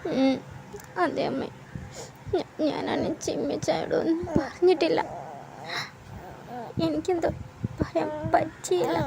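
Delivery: crying softly. Acted. Daughter confesses, crying.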